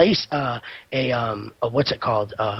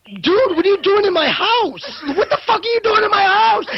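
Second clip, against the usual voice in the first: imitating a freaked-out victim; 13 semitones higher than usual. Retelling the homeowner confronting a robber, terrified and angry. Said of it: "he started freaking out".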